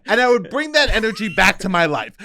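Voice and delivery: normal talking voice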